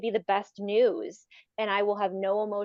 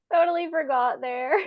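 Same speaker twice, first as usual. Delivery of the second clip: laughing; 5 semitones higher, 3 dB louder than usual. Real, not acted. Laughing at forgetting.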